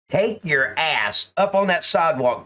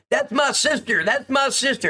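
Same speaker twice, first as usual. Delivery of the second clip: higher, whiny mock of her wail; mocking the screaming woman; 11 semitones higher than usual. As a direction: parrot her pleading, pitched up. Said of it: "This girl's been screaming earlier".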